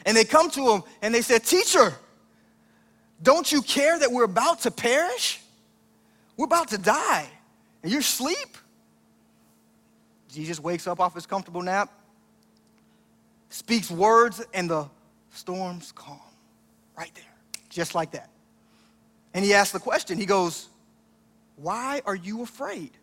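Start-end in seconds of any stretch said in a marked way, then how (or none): 1.4-8.6 higher, louder, frantic cry to the teacher; panicked plea. Retold or imitated. Disciples wake sleeping Jesus in storm, panicked plea. 21.6-22.9 calm question. Retold or imitated. Jesus calmly asks.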